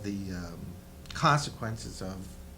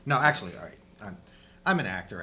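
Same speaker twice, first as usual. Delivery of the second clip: normal talking voice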